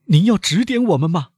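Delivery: high, loud, eager question; loud young man. A narrator's reading. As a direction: ask it out loud, pitched well up. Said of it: "年轻人大声地说". (年轻人大声地问)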